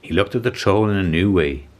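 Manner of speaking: usual speaking voice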